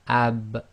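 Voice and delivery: "ab" with a small echo as the lips part; Arabic ab with echo. Shown as a demonstration. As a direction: close on b then release with a bounce. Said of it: "one of the echo letters".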